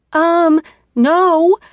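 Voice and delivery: high, loud indignant refusal; loud outraged no. Acted. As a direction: burst out in outrage.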